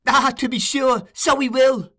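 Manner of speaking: gleeful echo. Acted. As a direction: echo it with glee. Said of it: "repeated Charlie".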